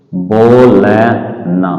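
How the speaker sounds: बोलना drawn out very slowly; बोलना धीरे धीरे; slow stretched word. Shown as a demonstration. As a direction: sound out बोलना slowly for children. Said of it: "धीरे धीरे बोलिए".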